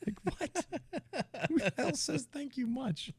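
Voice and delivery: high, laughing mock outrage; laughing disbelief. Real, not acted. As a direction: squeak it through laughter. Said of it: "laughing disbelief".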